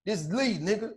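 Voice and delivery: lightly voiced soft-spoken tough talk; soft tough guy. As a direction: imitate a mild-voiced guy warning you off.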